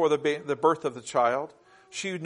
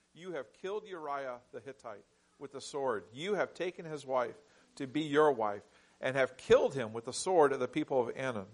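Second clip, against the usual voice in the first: much softer, grave accusation; hushed indictment; 3 semitones lower, 8 dB quieter than usual. Acted. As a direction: lay out the charges in a low hush. Prophet's indictment read quietly.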